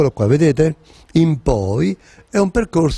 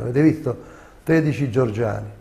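ordinary talk

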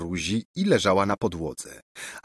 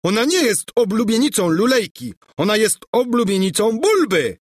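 high-pitched, furious bellow; hammed-up enraged prince; furious roar; 12 semitones higher, 4 dB louder than usual. A narrator's reading. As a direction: roar your claim at the rival. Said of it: "ryczał Bulbo".

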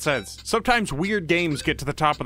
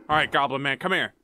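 ordinary talk

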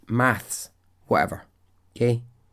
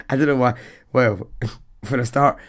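normal talking voice

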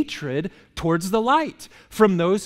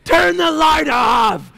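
higher, louder, slow yell from bed; groggy shout; 10 semitones higher, 8 dB louder, much slower than usual. Retold or imitated. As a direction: bellow at the light like a bear woken. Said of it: "And I go".

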